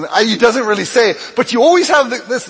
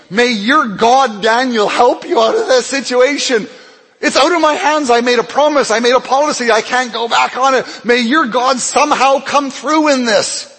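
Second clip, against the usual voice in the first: higher, pleading king's prayer; king praying helplessly for Daniel, higher and pleading; anguished king praying; 7 semitones higher, 3 dB louder than usual. Acted. King prays anxiously for Daniel.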